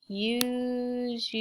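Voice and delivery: "usually" stretched out, u... su... al... ly; every syllable of "usually" held long; sounded out. Shown as a demonstration. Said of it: "sound it with me".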